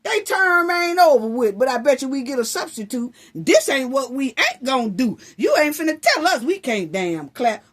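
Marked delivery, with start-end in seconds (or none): none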